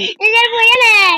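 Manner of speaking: loud laughing taunt; laughing shout. Real, not acted. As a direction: gloat loudly through a laugh. Teasing sister after she falls.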